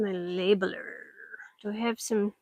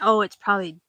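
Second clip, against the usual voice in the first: ordinary delivery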